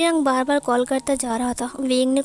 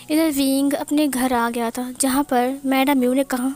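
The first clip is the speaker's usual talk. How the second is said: usual speaking voice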